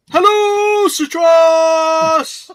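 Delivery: high, loud sing-song call; shouted greeting. Acted.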